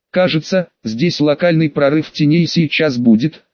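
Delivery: usual speaking voice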